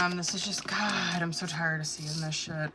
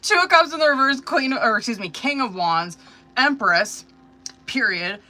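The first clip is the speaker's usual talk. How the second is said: cards announced high and loud; louder, higher restart; 4 semitones higher, 9 dB louder than usual. Shown as a demonstration. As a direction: call out the cards with energy. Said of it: "Hold on".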